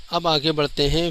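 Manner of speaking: ordinary talk, nothing marked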